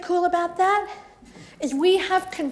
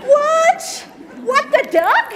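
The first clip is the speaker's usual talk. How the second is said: much higher, louder, cry of disbelief; mock shock; 11 semitones higher, 5 dB louder, slower than usual. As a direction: act outraged surprise at the joke. Mock shock at toxic thoughts.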